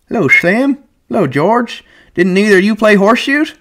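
put-on high, cracked old swamper voice; an old man shuffling in, chatty; old Candy. A narrator's reading. Old Candy greeting the men, high old voice.

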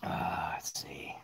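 slow, soft unvoiced mumble; slow hushed reading aside; whispered aside. Real, not acted. Reading chat quietly.

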